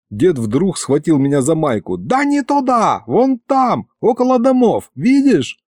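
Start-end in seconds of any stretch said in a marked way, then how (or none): 2.0-5.6 high, agitated grandpa; impatient pointing out. Acted. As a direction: steer his eyes there impatiently. Grandpa impatiently directing boy's gaze.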